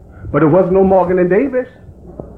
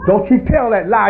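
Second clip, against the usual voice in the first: normal talking voice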